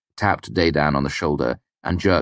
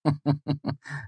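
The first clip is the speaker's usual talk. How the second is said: short scornful laugh, higher; dismissive laugh; 11 semitones higher, 5 dB quieter than usual. Acted. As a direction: brush it off with a laugh. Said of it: "I laughed dismissively".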